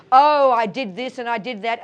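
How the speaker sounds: loud, hammed-up self-reproach; mock regret. Teacher imitating our regret over the day, loud mock self-blame. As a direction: fret loudly in mock regret. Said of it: "and you regret".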